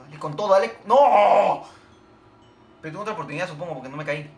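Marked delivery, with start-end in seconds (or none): 0.0-0.8 loud, pushing cheer-on; urgent urging. Real, not acted. Player urging his shot on, urgent shout. 0.9-1.6 long, loud, high "no"; real anguished shout. Real, not acted. Player watching his shot fail, anguished yell.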